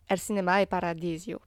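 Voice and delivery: a self-mocking Italian accent on the film title; a playful, admittedly bad Italian accent. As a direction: say it the Italian way, lightly. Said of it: "mon accent italien sucks".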